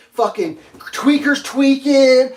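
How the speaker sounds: slow, loud, drawn out; incredulous about the scene; emphatic disbelief. Real, not acted. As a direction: lean hard on every word. Describing bums, emphatic.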